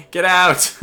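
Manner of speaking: loud, high-pitched yell; shouted retreat order. Pretend. Player to outmatched cruisers, shouted order to flee. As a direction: yell it suddenly at full volume.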